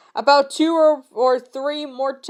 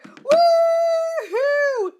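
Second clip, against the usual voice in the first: high, falsetto cheer. Real, not acted. Reader glad the fic is ending, high cheer. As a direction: whoop with relief. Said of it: "celebrating last chapter".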